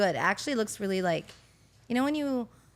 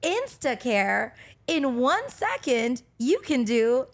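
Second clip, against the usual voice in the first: very slow, higher ad read; infomercial voice; 4 semitones higher, 6 dB louder, much slower than usual. Retold or imitated. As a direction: stretch it out like a TV ad. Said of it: "when I had to do these voiceover".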